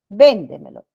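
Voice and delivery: "Véndemelo" with VÉN punched loud; stress on VÉN. Shown as a demonstration. Teacher giving another sobresdrújula word, loud stress on VÉN in "Véndemelo". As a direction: slam the first syllable of "Véndemelo", let the rest drop.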